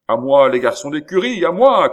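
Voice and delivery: put-on loud, high cry to the stable boys; shout for help. A narrator's reading. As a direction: yell for help, rising to full voice. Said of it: "cria l'hôtelier". (appel au secours crié, fort et aigu)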